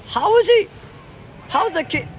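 high, loud disbelief; incredulous cry. Real, not acted. Gamer amazed at the kid's play, incredulous cry. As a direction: ask it in total disbelief.

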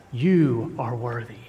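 ordinary talk

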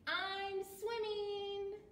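high, drawn-out character voice; Fluff's voice. A narrator's reading. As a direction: sing it out high and slowly. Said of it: "said Fluff".

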